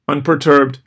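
ordinary delivery, nothing marked

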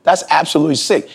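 high, appalled judgement; retold disgust, pitched high; disgusted verdict. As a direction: condemn it flatly, voice up. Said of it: "I'm like".

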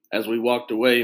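plain reading voice

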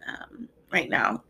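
a breathy whispered aside, choked up; barely voiced as tears come; tearful whisper. Real, not acted. To her listeners, overcome at year's end, whispering tearfully. Overcome while releasing emotions.

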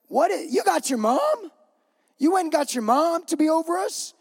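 high-pitched, incredulous mock outrage; acted indignant protest, rising in disbelief. Disciples protest at the brothers getting their mom, indignant. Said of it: "they were indignant at the two brothers".

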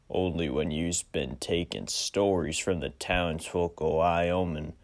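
wary gunslinger, sizing him up; western stranger drawl. Acted. Stranger answers.

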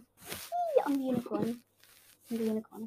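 lightly voiced toy unicorn. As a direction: squeal then a playful toy voice.

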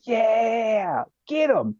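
high, drawn-out rallying whoop; hollered, high-pitched battle cheer; gung-ho cheering. Retold or imitated. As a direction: imitate a sergeant egging on his men. Retold sergeant cheering mid-firefight.

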